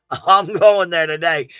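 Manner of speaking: laugh, then slowly declare "I'm going there today" loud and firm; emphatic declaration. Real, not acted.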